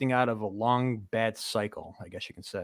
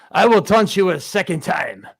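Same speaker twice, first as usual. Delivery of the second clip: hammed-up French accent, high and loud; mock French taunt; 6 semitones higher, 8 dB louder than usual. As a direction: taunt them like a cheeky Frenchman. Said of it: "No French accent allowed".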